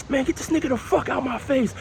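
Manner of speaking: retelling ex cursing at a colleague; mock cussing out. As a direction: imitate her swearing at a coworker. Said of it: "She starts cussing and shit".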